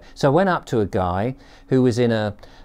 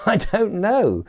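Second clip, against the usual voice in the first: high, drawn-out, laughing helplessness; helpless admission; 11 semitones higher, slower than usual. Retold or imitated. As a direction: admit you're clueless with a rueful laugh. Retold helpless admission to stranger.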